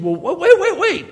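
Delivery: mock startled objection, high; surprised protest. Listener objecting to the gospel, surprised laughing protest. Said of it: "And you think".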